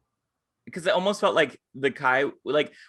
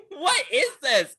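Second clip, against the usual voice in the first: high, slow, half-laughing incredulity; shocked disbelief; 12 semitones higher, slower than usual. Retold or imitated. Re-enacting the street confrontation for a friend, high and incredulous. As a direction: drag out the mock-appalled question.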